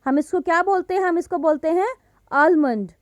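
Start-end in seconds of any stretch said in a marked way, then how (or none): 2.3-2.9 आलमंड with the L sounded, AL-mund; आलमंड as "al-mund", L before M; common mispronunciation. Shown as a demonstration. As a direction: put an audible L into आलमंड. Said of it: "what we have been saying".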